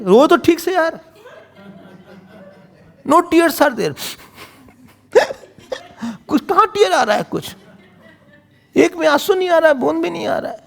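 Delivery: high, laughing teasing; laughing mockery. Real, not acted. Preacher laughs at Manthara's tearless crying, high teasing. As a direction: tease the fake tears through laughter.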